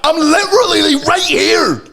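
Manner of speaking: high, loud comic bellow; exasperated shout. Retold or imitated. Overlooked son shouting to his ma Rosalind, exasperated. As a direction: yell it indignantly, stretching the last words.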